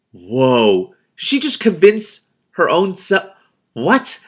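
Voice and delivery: loud, high, slow astonished cry; amazed exclamation. Real, not acted. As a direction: gasp out your amazement. Reader amazed by plot twist.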